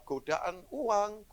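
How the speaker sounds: godaan uang dragged out slowly, each word leaned on; stretched-out warning about money; slow emphasis. Acted. Preacher listing temptations.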